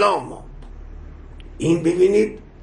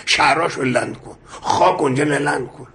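ordinary talk, nothing marked

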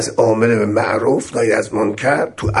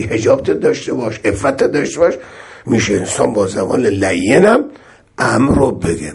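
ordinary talk

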